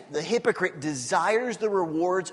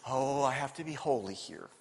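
mock holy; 5 semitones lower, 8 dB quieter than usual. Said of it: "put the halo on".

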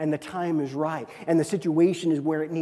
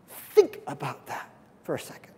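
hushed; 3 semitones lower, 6 dB quieter than usual. Real, not acted. Hushed aside in sermon.

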